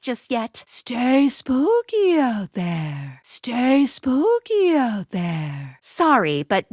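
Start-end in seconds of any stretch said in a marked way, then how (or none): 0.7-3.2 slow, drawn-out spooky voice; eerie farewell, words drawn long; spooky send-off. Acted. Spooky sign-off in game. 3.2-5.8 slow, drawn-out spooky voice; eerie farewell, words drawn long; spooky send-off. Acted. Spooky sign-off in game.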